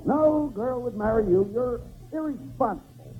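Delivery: usual speaking voice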